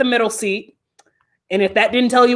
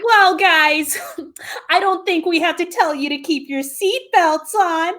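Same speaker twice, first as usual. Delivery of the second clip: raised, announcement-style mimicry; lightly voiced flight attendant; 7 semitones higher than usual. Attendant telling passengers after the drop, lightly imitated announcement. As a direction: lightly put on a cabin announcement voice. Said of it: "the lovely flight attendant comes on".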